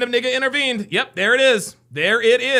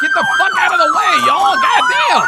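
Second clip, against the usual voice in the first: loud angry yell to clear the way; shouting; 4 dB louder than usual. Real, not acted. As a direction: bark at them to move. Yelling at people in the way.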